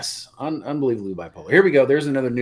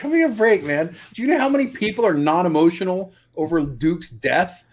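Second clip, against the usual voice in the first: higher, exasperated retold rant; mock indignation; 6 semitones higher, 3 dB louder than usual. Imitating Kirk mad over Duke's death, mock-exasperated. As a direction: act out a worked-up complaint, pitched up. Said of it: "this is what Kirk gets mad over".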